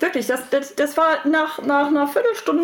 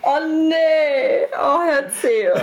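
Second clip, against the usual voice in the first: laughing through tears; 4 semitones higher, 4 dB louder, much slower than usual. Real, not acted.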